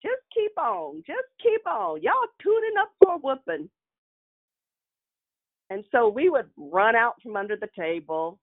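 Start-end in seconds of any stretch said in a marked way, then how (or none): 0.0-3.7 warning grandmother. Retold or imitated. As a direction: warn the kids they're in for it. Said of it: "she would always say".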